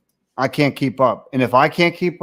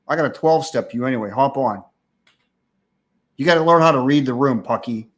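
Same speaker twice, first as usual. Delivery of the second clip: usual speaking voice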